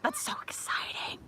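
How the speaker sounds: high, voiceless squeal of excitement; hushed, airy excitement; excited whisper. Real, not acted. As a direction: whisper it, thrilled. Thrilled at budding birch trees.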